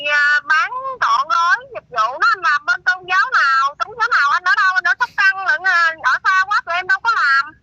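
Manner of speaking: ordinary talk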